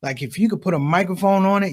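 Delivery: ordinary talk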